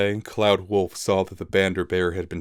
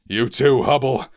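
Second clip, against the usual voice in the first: higher, louder shout; captain ordering Hubble to leave, shouting; 6 semitones higher, 6 dB louder than usual. Acted. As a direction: shout at the loyal beast. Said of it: "he shouted".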